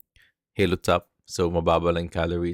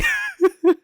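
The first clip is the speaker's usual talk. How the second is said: high laugh; 7 dB louder than usual. Real, not acted. Laughing at wacky photo.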